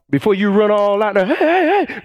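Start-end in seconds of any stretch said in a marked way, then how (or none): 1.3-2.0 high hurried calling. Retold or imitated. Acting someone rushing out.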